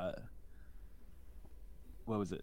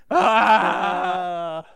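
startled yelp; 3 semitones higher, 12 dB louder than usual. Retold or imitated. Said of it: "walked in on someone naked and went".

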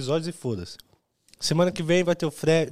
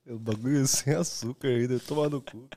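ordinary talk, nothing marked

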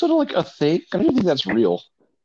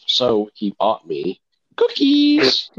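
drawn-out deadpan delivery; slow deadpan; 3 dB louder, much slower than usual. Acted. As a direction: drag the punchline slowly. Deadpan joke punchline.

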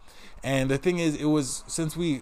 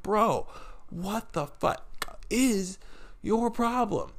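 high, halting, exasperated comeback; exasperated retort; 10 semitones higher, much slower than usual. Retold or imitated. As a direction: space out the words in disbelief. Retells confronting screaming boss.

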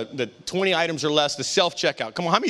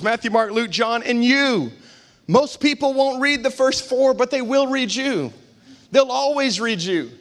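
raised, emphatic preacher voice; preaching; 7 semitones higher than usual. Real, not acted. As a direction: drive each line home to the congregation. Preaches at full force.

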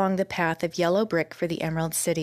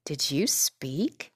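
slow question, mild wonder; a touch of astonished disbelief; 3 semitones lower, much slower than usual. Acted. Girl asks a scarecrow if he really spoke, mild wonder. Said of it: "asked the girl in wonder".

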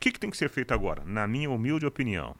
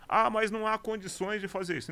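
high mocking whine; mock objector voice; 10 semitones higher than usual. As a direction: mimic a doubter's lame excuse. Quoting a doubter's objection.

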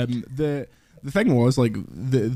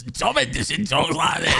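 higher northern mock voice; Tom Henderson impression; 4 semitones higher than usual. Shown as a demonstration.

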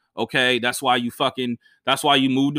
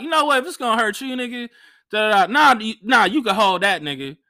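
high, breezy dismissive brush-off; mock dismissive; 6 semitones higher than usual. Shown as a demonstration. Comic showing how to handle promoter, high and dismissive. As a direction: wave him off, high and casual. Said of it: "You're like".

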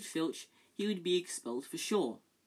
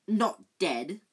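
choked; 4 semitones higher, 8 dB louder than usual. A narrator's reading. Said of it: "choked Filch".

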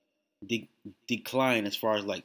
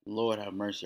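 ordinary talk, nothing marked

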